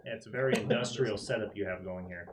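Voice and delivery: ordinary talk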